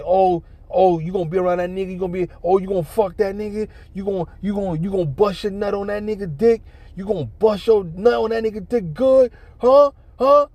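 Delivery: high-pitched imitation of an accusing rant; jealous ranting. Jealous man accusing his woman of cheating, imitated ranting. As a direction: act out his outrage, rising to loud, high "huh"s.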